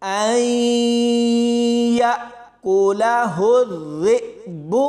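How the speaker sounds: long nasal ऐ, then या and ज़े cut short with a jolt; slow tajweed recitation of ऐ या कोला हु ज़े बु; ghunna and jerks. Shown as a demonstration. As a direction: recite slowly, holding ऐ and stopping sharply on hamza. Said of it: "दो जगह पर झटका देकर".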